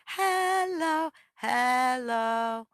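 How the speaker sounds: high sung "Hello, hello". Shown as a demonstration. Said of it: "a higher voice".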